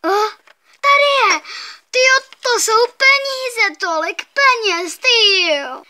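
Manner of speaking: high, loud, drawn-out amazement; gleeful pretend wow, slowly stretched; excited discovery. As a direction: play up the thrill of finding treasure. Finds treasure in pretend game.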